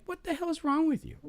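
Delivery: quoting himself. Retold or imitated. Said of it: "I'm like".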